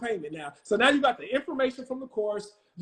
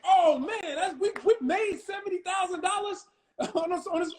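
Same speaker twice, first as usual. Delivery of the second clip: high, laughing burst of excitement; imitating excited partner; 9 semitones higher than usual. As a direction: play the thrilled partner, laughing mid-line. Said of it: "C J was like".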